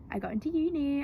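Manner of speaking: spring the good news on your sister; excited. Retold or imitated. Big sister telling Ellie she got into uni, thrilled and high.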